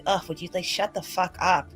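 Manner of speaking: ordinary delivery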